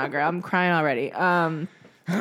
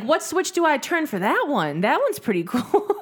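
teasing imitation of a carefree friend, pitched up; mock Keith, higher; 8 semitones higher than usual. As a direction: play him breezy and unbothered. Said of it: "Keith's like".

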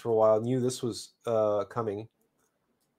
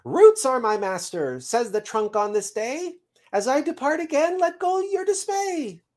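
very high squirrel voice; squirrel messenger reporting; 12 semitones higher, 3 dB louder than usual. Acted. Squirrel relays the trunk's answer to the seed, high chirp. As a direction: sail up high as the departing squirrel.

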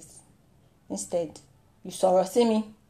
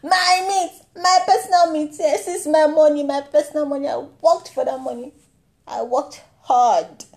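put-on high, loud whiny boy; K K B show boy; 5 semitones higher, 5 dB louder than usual. Boy from the show claiming his money. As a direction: whine like a possessive kid. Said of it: "that boy said".

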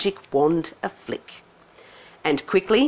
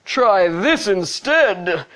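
loud, very slow princely yell; drawn-out theatrical shout; yelled retort; 3 semitones higher, 5 dB louder, much slower than usual. A narrator's reading. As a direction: yell it back, stretching every word. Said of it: "the prince yelled back".

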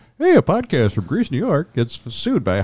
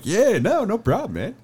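laid-back dude voice; 11 semitones higher than usual. Retold or imitated. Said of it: "I'm like".

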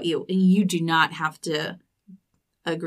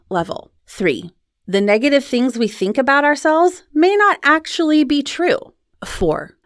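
steady scripted host read; podcast outro read. Acted. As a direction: read the wrap-up to listeners. Host reads episode outro.

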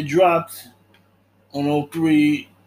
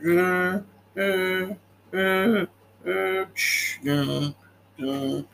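humming the film score; much slower than usual. Retold or imitated. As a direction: imitate the movie soundtrack with your mouth. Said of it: "that's the music if y'all were wondering".

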